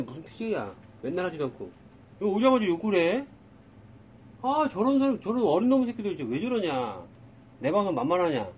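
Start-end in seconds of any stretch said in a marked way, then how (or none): 2.2-8.5 higher and louder, fed-up ranting; angry ranting. Real, not acted. Angry at newcomer swearing.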